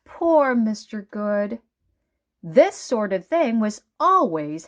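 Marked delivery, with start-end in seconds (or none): none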